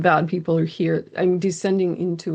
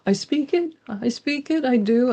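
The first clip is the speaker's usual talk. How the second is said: put-on much higher, timid little child; small child's voice; 7 semitones higher than usual. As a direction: speak like a scared small kid seeking permission. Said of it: "from my child's voice".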